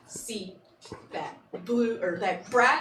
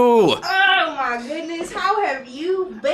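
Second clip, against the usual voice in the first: excited greeting; 5 semitones higher, 6 dB louder, slower than usual. Acted. Player greets old friend in character.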